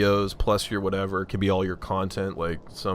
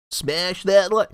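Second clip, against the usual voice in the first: high, slow YouTuber outro; 9 semitones higher, much slower than usual. Retold or imitated. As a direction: say it like a cheesy YouTuber. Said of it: "No, I'm not gonna do that".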